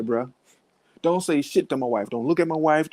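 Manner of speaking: ordinary delivery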